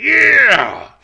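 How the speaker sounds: high, very loud rock scream; ecstatic fan yell. Acted. Fan whose blood gets going on rock music, ecstatic yell. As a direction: let out a wild fan's yell. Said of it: "in your brain, you're like".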